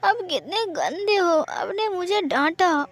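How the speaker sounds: put-on high, slow tearful child voice; a little boy weeping, high and drawn out; crying. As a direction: sob it out like a scolded child. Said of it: "रोते हुए कहा".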